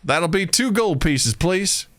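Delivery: high Southern vendor pitch; Southern bumpkin seller. Acted. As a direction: sell the steak with a high Southern twang. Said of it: "a bunch of Southern bumpkins".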